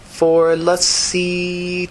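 high, drawn-out pondering; thinking aloud. A narrator's reading. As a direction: stretch it out while working out a price.

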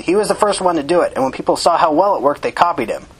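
defensive justification, starting high. A narrator's reading. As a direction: argue your case defensively. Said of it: "the man said defensively".

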